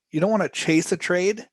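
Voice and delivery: ordinary talk, nothing marked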